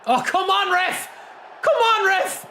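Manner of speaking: high, loud exasperated protest; pleading with the referee. Real, not acted. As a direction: yell at the ref in disbelief. Protesting referee decision in game.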